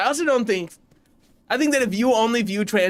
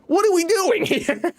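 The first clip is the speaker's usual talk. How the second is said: mock outrage; 11 semitones higher than usual. Mock scolding as voice director.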